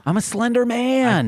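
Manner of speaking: playful put-on Minnesota accent, pitched high; mock Minnesota accent. Repeating his joke to friends in a mock Minnesota accent. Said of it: "we were in Minnesota".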